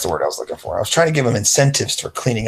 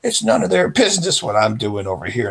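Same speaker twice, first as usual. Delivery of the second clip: grumbling friend; 7 semitones higher than usual. Retold or imitated. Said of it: "He's like".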